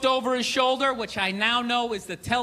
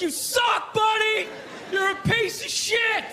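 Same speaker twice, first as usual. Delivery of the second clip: put-on drunk heckler yell; 8 semitones higher, slower than usual. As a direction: jeer at the player from the stands. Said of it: "you're like".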